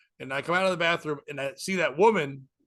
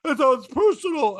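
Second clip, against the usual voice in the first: imitated insistence, higher and louder; hammed-up triumphant retort; insistent woman; 10 semitones higher, 6 dB louder than usual. Comic voicing the woman proving her point, high and loud. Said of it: "And she goes".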